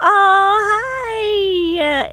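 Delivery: high, loud burst of hello; excited greeting. Real, not acted. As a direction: greet the viewers with a bright, surprised lift. Greeting viewers on camera.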